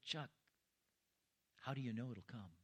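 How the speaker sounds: softly, as God's still small voice; a gentle, hushed question from the Lord. Retold or imitated. The Lord quietly asks Chuck about his faith, hushed. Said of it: "that still small voice".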